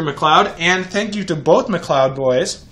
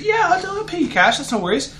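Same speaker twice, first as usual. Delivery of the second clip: much higher, eager friend voice; high quoted voice; 13 semitones higher than usual. Retold or imitated. Quoting Kate offering cash.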